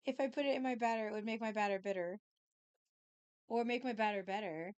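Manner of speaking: fast tongue twister. Shown as a demonstration. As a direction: rattle off the twister quickly.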